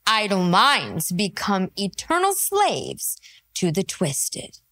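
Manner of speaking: low, slow trailer-voice tagline; brooding narrator opening; ominous intro. Acted. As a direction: intone it like a dark movie trailer.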